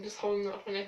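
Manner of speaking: ordinary delivery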